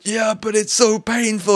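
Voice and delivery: high whimpering protest; whiny high complaint. Retold or imitated. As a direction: whine like a hurt child. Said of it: "I said".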